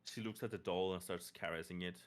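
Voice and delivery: normal talking voice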